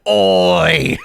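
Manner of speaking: long drawn-out Cockney 'Oi'; Cockney accent. Shown as a demonstration. Attempting a Cockney accent on request, drawn out. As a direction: call out one stretched Cockney word. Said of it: "Give me a Cockney accent".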